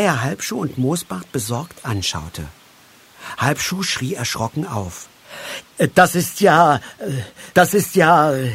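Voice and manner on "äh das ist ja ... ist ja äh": loud, raised, halting stammer. A narrator's reading. Moosbart recognises the dog's find, stammering. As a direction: stumble over the words in shock. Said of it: "stammelte Moosbart".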